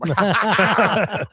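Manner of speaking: loud burst of reenacted laughter; cracking up. Dad retells laughing at his son's fart, cracking up. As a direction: crack up high and hard. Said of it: "and I'm cracking up".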